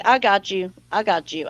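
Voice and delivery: normal talking voice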